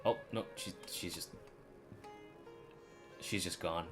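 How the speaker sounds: ordinary delivery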